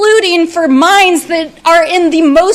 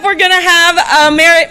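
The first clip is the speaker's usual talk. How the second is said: ordinary delivery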